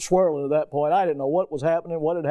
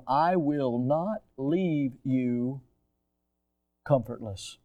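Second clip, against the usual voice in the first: solemn slow quote; 5 semitones lower, 5 dB quieter, much slower than usual. Acted. Quoting Jesus solemnly.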